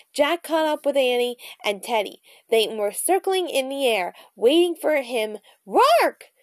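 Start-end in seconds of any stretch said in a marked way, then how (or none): 5.7-6.3 high bird caw; raven croak. A narrator's reading. Jack catching up with the other ravens, high croak. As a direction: caw it out sharply. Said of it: "Jack croaked".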